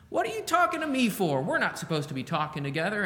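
a bright, bouncy voiced woman at the well. Shown as a demonstration. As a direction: toss the question off lightly and bouncily, way up high. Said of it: "I'm kinda giving it that bouncy tone of voice".

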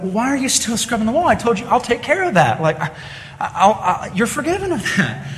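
a parent baffled and hurt, voice up high; puzzled parent. Retold or imitated. Parent finds child still scrubbing.

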